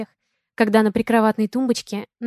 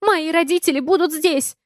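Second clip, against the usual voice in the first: higher, half-shouted panic; shouting back; 7 semitones higher than usual. A narrator's reading. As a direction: wail it back in rising alarm. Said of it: "кричу в ответ".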